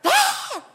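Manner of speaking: short, high wailed "ah". Shown as a demonstration. Praying boldly straight into the throne room, high wail. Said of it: "and just go".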